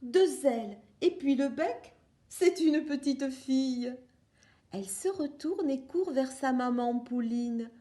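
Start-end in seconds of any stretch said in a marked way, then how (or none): none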